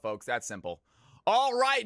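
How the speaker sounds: ordinary talk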